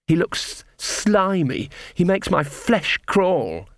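slow, high-pitched disgust; dark. A narrator's reading. Ella describes the prince to Magrat, darkly. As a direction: draw out the loathing. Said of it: "said Embers darkly".

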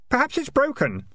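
put-on high, innocent boy's voice; mock helpful. A narrator's reading. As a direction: sweetly suggest it. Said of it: "said Keith, helpfully".

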